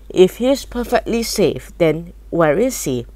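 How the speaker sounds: ordinary delivery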